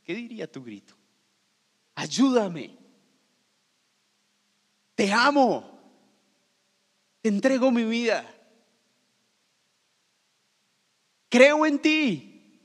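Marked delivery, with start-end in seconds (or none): 2.0-12.6 rising, louder heartfelt cry; a fervent prayer shouted upward; pleading cry. Pretend. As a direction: cry out to God, slower at the end. Imagined cry to Jesus.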